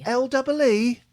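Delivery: high, loud operator query; high lady voice. Acted. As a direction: pitch it up as the lady. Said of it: "I'm a lady".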